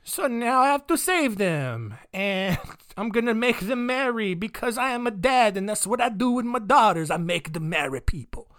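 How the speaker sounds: put-on Portuguese prince voice, starting high and sinking; mock-proud father with a botched Portuguese accent; bad Portuguese accent. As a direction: declare it in a clumsy fake Portuguese accent. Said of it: "I really don't know what accent Portuguese is".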